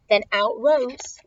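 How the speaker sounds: ordinary delivery, nothing marked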